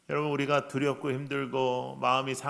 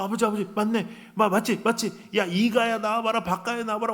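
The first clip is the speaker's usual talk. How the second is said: excited villager calling out; 5 semitones higher, 4 dB louder than usual. Retold or imitated. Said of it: "둘째가 뭐".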